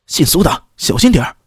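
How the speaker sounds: high-pitched, urgent called-out warning; hurried warning call. A narrator's reading. Warning a comrade about the golden snake, hurried call. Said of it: "忙大声喊道".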